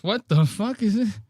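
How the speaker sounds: high mock shock; lightly voiced shocked Shane; imitating Shane. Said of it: "Shane goes".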